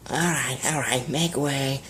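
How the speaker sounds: slow, clearing a path through the crowd; gruff bouncer. A narrator's reading. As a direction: push through like security.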